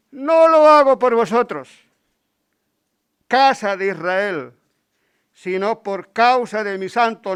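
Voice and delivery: loud, ringing reading of the prophet's words; preaching scripture reading. Acted. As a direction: proclaim it to the whole church. Lectura bíblica a plena voz. (lectura bíblica proclamada con fuerza)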